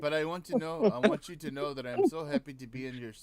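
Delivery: mock Indian accent, loud and fast; a jokey Indian accent pushed out fast. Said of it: "why you're talking like an Indian".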